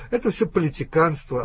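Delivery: normal talking voice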